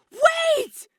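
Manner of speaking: all-out cry to halt them; desperate shout. A narrator's reading. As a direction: shout it at the top of your lungs, high and loud. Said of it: "Shuichi shouted, as loud as he could".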